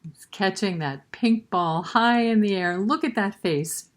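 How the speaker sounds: ordinary delivery, nothing marked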